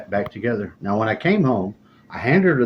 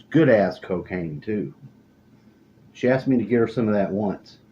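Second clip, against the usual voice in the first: usual speaking voice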